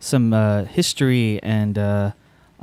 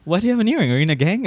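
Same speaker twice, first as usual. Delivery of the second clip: parent questioning; 8 semitones higher, much faster than usual. Retold or imitated. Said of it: "you know, like".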